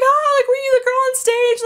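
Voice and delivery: high, loud excited fan voice. Retold or imitated. Fans leaving the arena ask her, high and loud excited. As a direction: squeal it like a starstruck fan. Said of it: "they were like".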